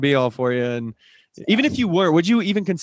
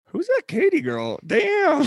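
high-pitched, drawn-out mock awe; retold admiring reaction; 13 semitones higher, much slower than usual. Host recalling her walk down the stairs, high admiring exclamation. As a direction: exaggerate being wowed, slow and high.

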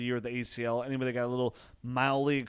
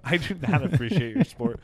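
louder, higher, laughing as it is said; laughing retort; 5 semitones higher, 7 dB louder than usual. Real, not acted. Host overrules co-host's thanks to listeners, laughing protest. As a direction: push each word out through laughter.

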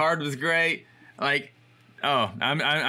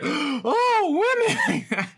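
put-on high-pitched, louder gasp of an old lady scandalized; shocked old lady; 5 dB louder, much slower than usual. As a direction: play the sweet old shopkeeper nearly fainting at the sight. Old lady repeats, falling down.

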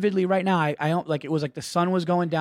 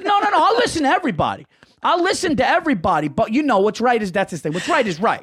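protesting dad; 6 semitones higher than usual. Retold or imitated. Son imitates dad claiming he listens, high defensive protest. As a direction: imitate a dad insisting he's open-minded. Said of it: "he always acts like he's like".

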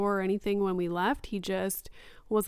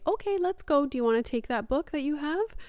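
lightly voiced upbeat mom, pitched up; higher-pitched coaxing, as if to a toddler; a hint of singsong parent talk; mom to child; 6 semitones higher, faster than usual. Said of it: "And I say".

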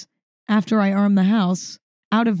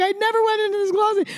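hysterical denial; 12 semitones higher, faster than usual. Retold or imitated. Mom insists, hysterical.

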